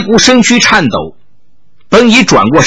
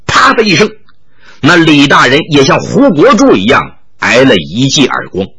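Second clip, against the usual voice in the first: ordinary delivery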